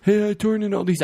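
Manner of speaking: high-pitched imitation of a passenger; mock casual announcement. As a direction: put on a high voice for the other guy. Acts out someone in a car.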